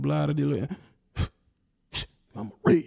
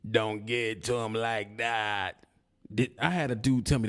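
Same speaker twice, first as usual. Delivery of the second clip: ordinary talk, nothing marked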